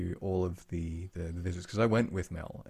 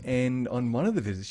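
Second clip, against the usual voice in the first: ordinary talk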